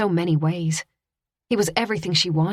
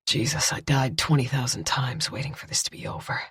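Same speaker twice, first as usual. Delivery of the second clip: low, hushed male relief; relieved man; 3 semitones lower, 5 dB quieter than usual. A narrator's reading. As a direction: breathe out the fear. Kyle relieved, embracing her.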